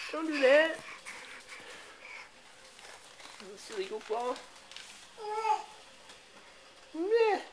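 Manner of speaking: put-on high sing-song cooing at a toddler; baby talk. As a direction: coo at the baby, all delight. Cooing over walking baby son.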